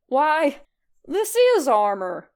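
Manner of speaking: loud grand declaration; loud mock-grand proclamation, slowed on armor; proclaimed. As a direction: announce it like a herald. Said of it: "Red proclaimed".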